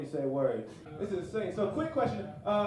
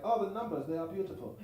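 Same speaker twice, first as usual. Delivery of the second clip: put-on softer, dreamy German accent; mock German accent; 6 dB quieter than usual. Comic imitating a German fan of numbers in a German accent.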